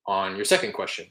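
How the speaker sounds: ordinary delivery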